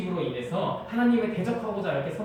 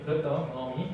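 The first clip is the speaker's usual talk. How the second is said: usual speaking voice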